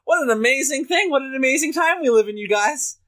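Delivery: high, sweet, gushing housewife voice; seventies housewife. Retold or imitated. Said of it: "you sound like a seventies housewife".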